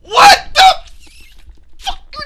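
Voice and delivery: very high, loud shriek; shocked yell. Real, not acted. Player shocked by the game, high yell. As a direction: yelp it in total disbelief.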